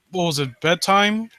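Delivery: ordinary talk, nothing marked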